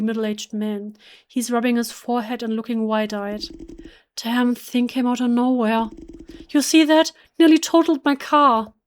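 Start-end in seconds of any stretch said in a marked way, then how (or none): 4.2-8.7 a driver blurting it out, still jolted by the crash; shaken driver. Acted. Shaken driver after hitting deer.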